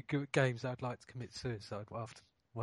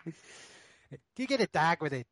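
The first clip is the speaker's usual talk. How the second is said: mock Aussie; 3 semitones higher, 4 dB louder, slower than usual. Aussie accent banter continues.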